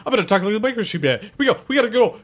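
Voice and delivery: high, loud, fast cartoon robot voice. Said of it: "that was like".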